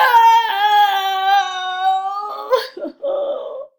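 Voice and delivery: long high anguished wail; loud wailing cry. Acted. Elephant wailing over dropped ice cream, loud and long. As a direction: howl at the loss.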